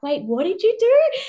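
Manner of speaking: high, eager wait what; curious surprise. Retold or imitated. Women in the circle asking her, curious and surprised. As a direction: pop up with sudden curiosity.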